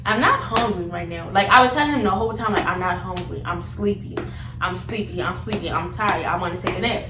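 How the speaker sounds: ordinary delivery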